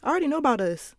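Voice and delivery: high, mocking voice of defensive guys; mimicking a guy's whiny deflection; mocking dudes.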